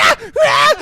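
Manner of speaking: very high, loud terrified shrieks; panicked screams. Retold or imitated. Honey Bunny screaming.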